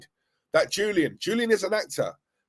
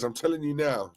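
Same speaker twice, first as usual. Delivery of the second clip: usual speaking voice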